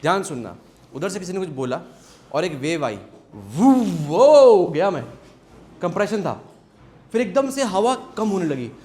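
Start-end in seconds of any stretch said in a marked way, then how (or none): 3.4-4.6 slow, loud double swoop, "woo, whoa"; drawn-out wave whoosh. Shown as a demonstration. Teacher as air particle hit by a wave, long loud whoosh. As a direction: get swept away by a wave of sound.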